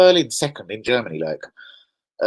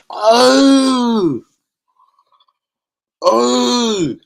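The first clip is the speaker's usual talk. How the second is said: anguished cry; 12 semitones higher, 8 dB louder than usual. Real, not acted. Anguished groan over a miss.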